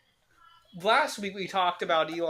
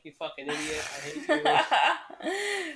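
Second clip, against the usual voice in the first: mock scolding, laughing; 9 semitones higher, slower than usual. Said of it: "and you're like".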